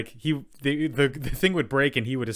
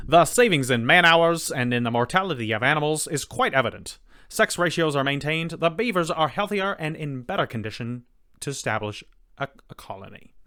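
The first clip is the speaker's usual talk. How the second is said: put-on louder old Hollywood transatlantic accent; 4 semitones higher, 4 dB louder than usual. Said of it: "that transatlantic accent all over it".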